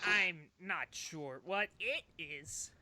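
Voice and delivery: slow, deliberate in-character reply; measured creature leader, breaking into a brief laugh; bird lady leader. Acted. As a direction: grant it slowly, weighing each word. Game master answers as bird lady leader.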